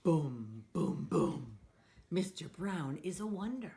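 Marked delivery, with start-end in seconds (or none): 0.0-1.6 deep boom. Acted. Thunder sound in the story.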